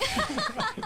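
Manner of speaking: high burst of laughter. Real, not acted. Laughing at own impression.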